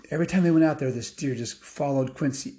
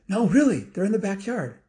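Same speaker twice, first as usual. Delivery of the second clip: put-on high, louder kid insisting; insistent child; 8 semitones higher, 3 dB louder than usual. Quincy insists to doubting parents, high and excited. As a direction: plead to be believed, pitched up high.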